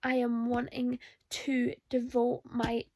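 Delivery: normal talking voice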